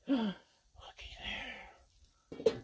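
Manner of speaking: hushed whisper of wonder. Acted. Cook lifting the lid to show viewers, whispered. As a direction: breathe it like a secret reveal.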